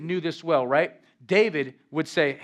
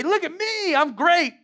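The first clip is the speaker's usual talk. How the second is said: high-pitched show-off crowing; mock self-praise, pitched way up; mock boasting; 11 semitones higher than usual. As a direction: brag in a hammed-up high voice. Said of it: "get in the presence of God and say".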